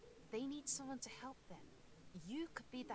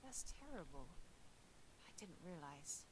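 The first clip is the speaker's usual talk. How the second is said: soft, sorry and tender; sympathetic; 3 semitones lower, 7 dB quieter than usual. Acted. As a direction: comfort him gently. Sympathising with scared mole.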